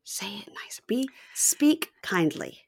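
soft breathy whisper, slow. Real, not acted. Host whispering advice to the couple, a soft whisper. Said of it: "whispered aside".

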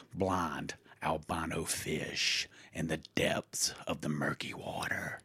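slow, eerie whisper; spooky whisper. Acted. Host whispering eerily about blind cave fish. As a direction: hush it like a campfire ghost story.

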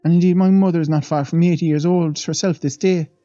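a lightly voiced old maid, awed; old Irish housemaid. The maid leans in to the young men, lightly voiced and awed. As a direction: tell it like a wide-eyed servant gossiping.

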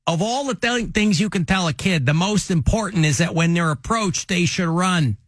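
comic safety-talk voice; J J Bittenbinder. Retold or imitated. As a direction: lecture about kids like an old-school cop. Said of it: "this is what you would say".

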